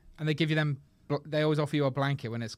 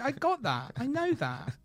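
impatient; 9 semitones higher, slower than usual. Retold or imitated. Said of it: "It's like".

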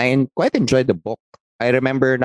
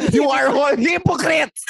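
high, slow hammed-up yell; playful imitation of a shrieking teacher; mock shout; slower than usual. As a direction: bellow it like the furious teacher. Friend mimics teacher's outburst.

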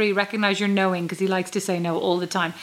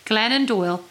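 ordinary talk

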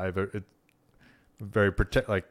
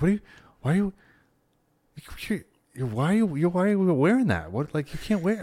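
imitating outraged old woman; 9 semitones higher, faster than usual. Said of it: "she's like".

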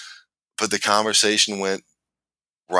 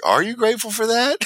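pitched way up, teasing; playful mock question. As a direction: ask it like a wide-eyed kid teasing his wife. Retelling asking wife a question.

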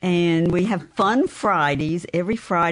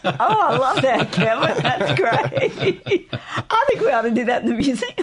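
laughing; 7 semitones higher than usual. Real, not acted. Laughing at the coyote anthem joke.

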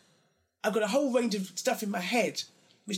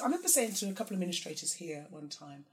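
ordinary talk

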